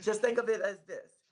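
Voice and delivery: slight lisp, retainers in; a retainer lisp on every s. Real, not acted. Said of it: "so I'm kinda lisping".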